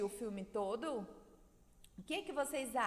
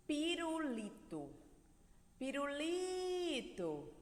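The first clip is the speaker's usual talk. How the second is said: pirulito with a long LI; slow piru-LIII-to, held and then falling; calling from afar; 3 semitones higher, 3 dB quieter, slower than usual. Shown as a demonstration. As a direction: call out pirulito, stretching the stressed LI. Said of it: "Como se o pirulito estivesse longe".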